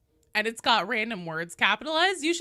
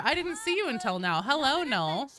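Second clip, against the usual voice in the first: ordinary delivery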